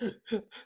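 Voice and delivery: short imitated gasps for air; breathless gasps. Showing how she woke up unable to breathe, gasping. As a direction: act out sucking air in without letting it out. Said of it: "I'm breathing in, but I cannot".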